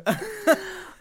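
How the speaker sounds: a very high, loud squeal of laughter; shrieking laugh. Real, not acted. Host cracking up at her own dirty line, high shrieking laugh.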